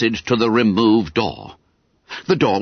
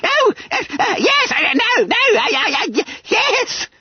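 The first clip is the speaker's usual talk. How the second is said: very high, stammering squeal; gasping. A narrator's reading. Frightened boy answering his schoolmaster, gasping and stammering. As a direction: dither in fright between answers. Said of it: "gasped Bunter".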